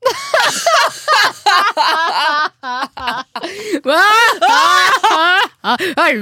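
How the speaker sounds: long, high, helpless cackle; loud laughter. Real, not acted. Co-host cracking up at their own irony, laughing loudly. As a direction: burst into real, loud, high-pitched laughter.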